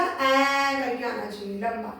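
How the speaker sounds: ए stretched as a long open "ai"; long ai sound. Shown as a demonstration. Teacher showing the two-matra sound, ए drawn out as "ai". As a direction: hold the open vowel ए long. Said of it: "ए करके आना चाहिए लंबा".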